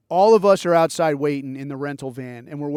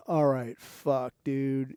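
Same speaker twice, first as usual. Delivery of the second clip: low, quiet, sinking "oh no"; deflated realization; 4 semitones lower, 7 dB quieter, much slower than usual. Retold or imitated. Man talking to himself after a hookup, deflated. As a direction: mutter it to yourself, lightly deflated. Post-sex moment of clarity, deflated.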